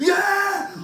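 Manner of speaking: high, loud triumphant yell; celebratory scream. Retold or imitated. Friends celebrating the arrest, screaming with joy. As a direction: scream it like the cops just called with good news. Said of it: "we were fucking screaming".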